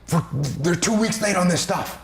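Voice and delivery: mock upset team member; frustrated complaint. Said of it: "you go".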